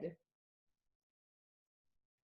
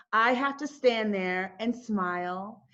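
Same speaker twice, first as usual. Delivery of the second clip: normal talking voice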